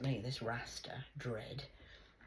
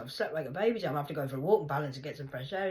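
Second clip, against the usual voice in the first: retold own voice; 3 semitones higher, 9 dB louder, faster than usual. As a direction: rattle it off brightly. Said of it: "I said".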